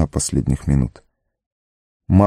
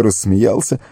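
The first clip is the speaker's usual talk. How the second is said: ordinary delivery, nothing marked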